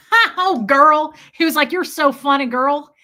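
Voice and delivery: put-on loud, high, laughing "girl" like the sergeant; amused sergeant. Imitating the sergeant laughing at her joke, loud and high. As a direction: boom it out like a chummy officer. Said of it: "he was so funny".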